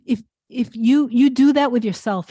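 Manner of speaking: usual speaking voice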